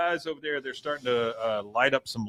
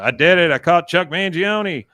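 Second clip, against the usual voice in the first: triumphant; 5 semitones higher, 8 dB louder than usual. Retold or imitated. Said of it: "He goes".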